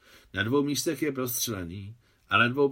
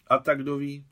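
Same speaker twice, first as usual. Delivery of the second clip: plain reading voice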